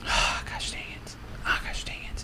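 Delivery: whispering. Real, not acted. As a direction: sigh and whisper the curse. Frustrated muttered curse.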